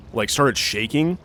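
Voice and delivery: ordinary talk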